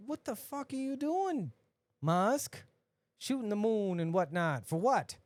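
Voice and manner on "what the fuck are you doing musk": mock outrage. Addressing Musk in outrage.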